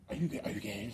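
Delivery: low, hushed mock questioning; quietly acted question. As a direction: drop it low and soft, almost under the breath. Acts out a blunt question.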